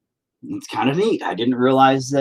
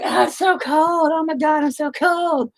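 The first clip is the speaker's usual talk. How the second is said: mock whiny child. Dad imitating his kids complaining, high whiny voice. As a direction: whine like a cold kid. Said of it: "You're like".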